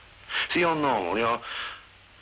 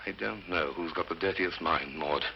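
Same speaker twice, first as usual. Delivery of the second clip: low, quiet dig at a relative; understated low-voiced bitterness; bitter retort; 6 semitones lower, 6 dB quieter than usual. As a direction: say it lower and softer, with quiet bitterness. Bitter retort to his sister Maud.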